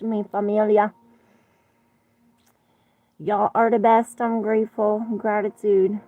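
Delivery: an excited, affectionate goodbye to the viewers; gushing sign-off. Real, not acted. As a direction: beam your love at the viewers.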